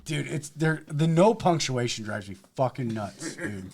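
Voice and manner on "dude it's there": ordinary delivery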